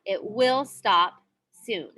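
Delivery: slow, "will" pressed firmly; teacher stressing "will". Shown as a demonstration. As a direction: spell out "will" slowly to show the future. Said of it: "indicating it will happen in the future".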